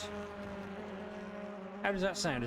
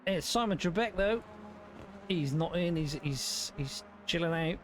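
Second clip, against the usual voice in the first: race commentary; 4 semitones higher than usual. Acted.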